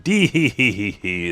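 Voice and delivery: giggle. Retold or imitated. Shuri giggling on floor.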